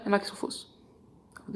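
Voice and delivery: secretive whisper. Shown as a demonstration. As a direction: drop to a whisper. Second half whispered.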